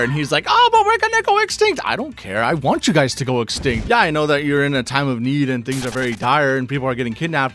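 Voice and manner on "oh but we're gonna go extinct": much higher, squeaky whining plea; high mocking whine. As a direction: squeak it out like a pathetic little creature. Imitating a pleading Cragnon.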